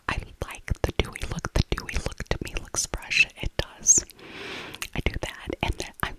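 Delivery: ordinary talk, nothing marked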